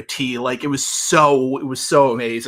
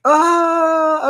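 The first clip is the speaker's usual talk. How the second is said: high, drawn-out mock wail; imitated grandma; 3 dB louder than usual. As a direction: wail like a complaining grandma, in fun. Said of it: "would constantly do impersonations of my".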